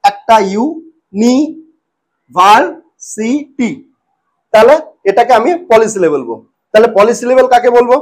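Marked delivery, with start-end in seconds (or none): 0.5-3.7 "ইউ, নি, ভার, সি, টি" one at a time, long gaps; split into syllables. Shown as a demonstration. Teacher counting the parts, "ইউ, নি, ভার, সি, টি" each said separately. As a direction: count out "ইউ, নি, ভার, সি, টি" as isolated beats. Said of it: "কটা ভাগে ভাঙলাম".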